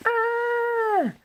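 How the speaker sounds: a short high shriek; high scream. Acted. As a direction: shriek with sudden fright. Speeding out of control.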